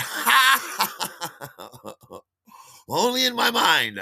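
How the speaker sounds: high, loud guffaw falling away, then exclaim; hammed-up self-mocking laughter; showy laugh. Asked if he's a dancer, showy self-mocking laugh.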